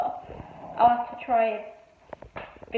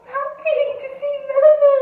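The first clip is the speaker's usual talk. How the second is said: voicing the pet; 13 semitones higher, 7 dB louder than usual.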